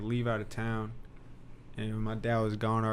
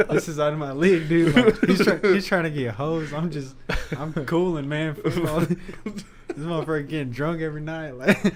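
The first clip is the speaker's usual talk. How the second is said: loud, higher, laughing through the words; 4 semitones higher, 9 dB louder than usual. Real, not acted. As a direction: crack up while telling the story. Cracking up at own story.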